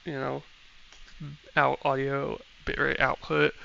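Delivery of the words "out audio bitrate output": ordinary delivery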